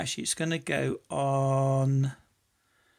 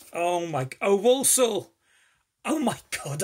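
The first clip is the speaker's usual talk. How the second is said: high, loud delighted gasp at a longed-for gift; overjoyed oh my God; thrilled surprise; 5 semitones higher, 7 dB louder than usual. Real, not acted. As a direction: burst out with real joy at the gift. Opens a lock he always wanted.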